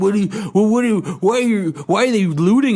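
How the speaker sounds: hammed-up confrontational neighbour; mock angry neighbour. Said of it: "He's like".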